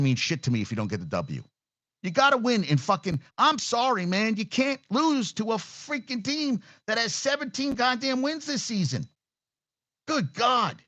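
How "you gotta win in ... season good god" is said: high-pitched, disbelieving outburst; exasperated rant. Real, not acted. Fan ranting about a bad loss, exasperated and high. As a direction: voice climbs way up in frustrated disbelief.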